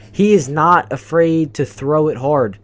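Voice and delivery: ordinary talk, nothing marked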